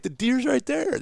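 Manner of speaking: very high insistent call; calling out again. Retold or imitated. Repeats call to distant hunters.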